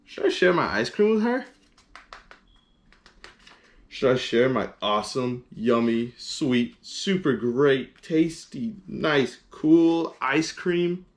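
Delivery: torn elephant. Acted. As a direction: agonize over every lovely word. Gerald torn over sharing.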